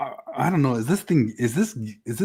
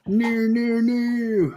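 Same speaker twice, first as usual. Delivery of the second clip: high whiny refusal; 10 semitones higher, much slower than usual. Pretend.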